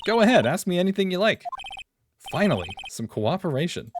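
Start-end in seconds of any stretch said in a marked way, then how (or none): 0.1-1.4 put-on Southern accent. Same Southern character voice.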